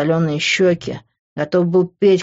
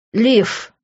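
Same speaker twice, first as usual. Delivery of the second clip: higher-pitched cry of her name; restrained narrated shout; calling out; 6 semitones higher than usual. A narrator's reading. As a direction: call out to her urgently, at moderate volume. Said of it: "закричал я".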